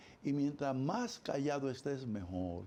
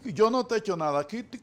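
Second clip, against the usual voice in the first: loud, higher, irritated retort; annoyed partner; 5 semitones higher, 7 dB louder than usual. Retold or imitated. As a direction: throw it back at a sulking partner. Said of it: "uno se cree culpable".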